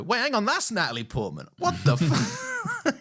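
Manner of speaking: stunned double take rising high; realizing the trick, baffled; surprised. Retold or imitated. Watching the film, he blurts out his surprise, pitch shooting up. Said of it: "And I go".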